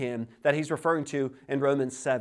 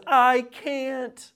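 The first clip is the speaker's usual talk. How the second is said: high, louder mimicked child's refusal; child refusing; 10 semitones higher, 5 dB louder than usual. Three-year-old to father asking for cup, mimicked high refusal. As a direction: imitate a small child simply refusing, voice pitched up. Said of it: "she just simply says".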